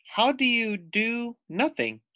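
put-on high, eager young girl; little girl voice. [name] greets the lizard in a high little girl voice. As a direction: ask brightly like a small child.

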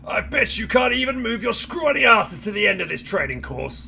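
high, loud British drill sergeant. Acted. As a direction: bark it like a British drill instructor. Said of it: "It's a British guy".

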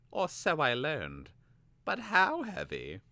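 put-on high, eager young apprentice voice; Vilnix voice.

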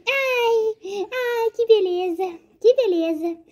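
put-on high, dreamy small boy; blissful little boy voice. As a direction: sigh with pure delight. Voicing son doll.

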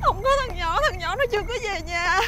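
sobbing, high and breaking; tearful despair, words choked by crying; crying in panic. Acted. Mother tells the man her son is missing, crying in despair.

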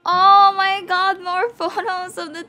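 high, squealed cries of joy; loud burst of delight, stretched out high; ecstatic fangirling. Real, not acted. Thrilled at more photos of members.

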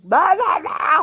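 mock chatter. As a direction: parody of endless chatter. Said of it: "I don't want you to think I'm like".

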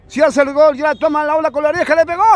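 rising excitement. Real, not acted. Penalty kick commentary build-up.

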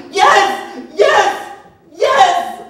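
very high, very loud screams of surrender; screamed confession. Retold or imitated. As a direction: scream out yes to make it stop. Boy breaks and confesses screaming.

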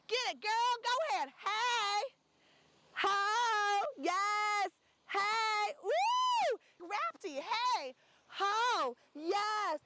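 high whooping encouragement; high excited whoops to the model; hype cheers. Real, not acted. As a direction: cheer her on through every pose. Hyping model during shoot.

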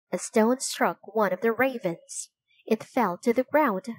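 usual speaking voice

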